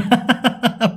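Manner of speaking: short laugh. Real, not acted. Laughs at own joke.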